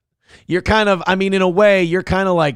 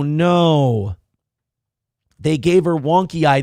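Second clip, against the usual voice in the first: ordinary talk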